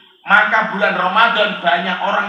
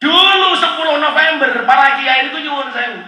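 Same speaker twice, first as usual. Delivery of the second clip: usual speaking voice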